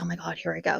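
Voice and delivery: nervous murmur. Retold or imitated. Client watching man in pain, soft nervous murmur. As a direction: steel yourself under your breath. Bracing for the needle.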